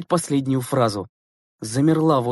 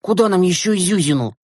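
high, pressing protest; indignant; 5 semitones higher, 4 dB louder than usual. A narrator's reading. Boy protesting at sharing his seat, indignant. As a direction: object as if crowded out. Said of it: "возмутился Глеб Елизаров".

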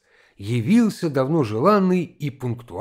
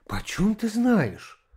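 high, drawn-out anxious question; mildly frightened; 7 semitones higher, 4 dB quieter, slower than usual. A narrator's reading. Wife interrupting her husband, mildly frightened. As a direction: question slowly with a touch of alarm. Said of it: "как будто испугавшись чего-нибудь".

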